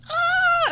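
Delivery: high-pitched imitated scream; mock freak-out shriek, held high. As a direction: squeal "Ah!" far above normal pitch. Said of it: "supposed to be, like, freaking out".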